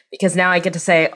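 ordinary delivery

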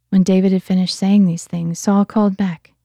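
usual speaking voice

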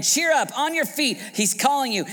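high, urgent rousing call; rally someone onto their feet; loud, high-pitched encouragement. Acted. Crowd calls to the blind man.

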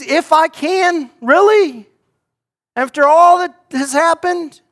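incredulous, rising high and loud; indignant disbelief, voice climbing; Jesus astonished. Acted. Preacher voicing Jesus to the doubting father, astonished and indignant. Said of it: "I imagine hearing this".

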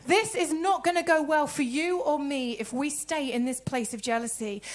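usual speaking voice

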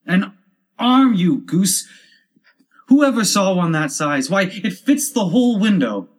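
high, loud exasperated rabbit; indignant, high-pitched outburst; angry scolding. Acted. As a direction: scold the foolish servant. Angry rabbit scolds his servant.

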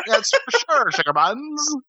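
high, hammy fake Southern accent; overacted Southern movie ham; bad Southern acting. Showing how he'd act as actor, fake Southern accent. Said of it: "that's how I would always act".

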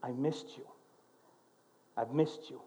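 soft, hushed I missed you; a wife's gentle, quiet welcome; tender. Retold or imitated. Wife greeting her husband after long apart, softly. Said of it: "she says to him".

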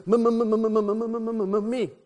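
high-pitched, eager stuttering Smurf; can't get the word "me" out. Acted. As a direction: stutter on the M in one long rush before "me". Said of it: "Stutter Smurf".

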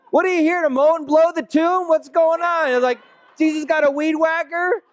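high, teasing disbelief; mock incredulity. Preacher mocks Mary's gardener mistake, high and teasing. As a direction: ham up the absurd gardener idea. Mocking idea of Jesus gardening.

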